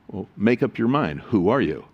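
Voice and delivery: ordinary talk, nothing marked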